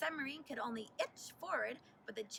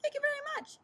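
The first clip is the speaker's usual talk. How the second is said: much higher, louder thanks; grateful Gulliver; 13 semitones higher, 7 dB louder, much faster than usual. A narrator's reading. Freed sailor thanks the class, high and relieved. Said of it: "said Gulliver".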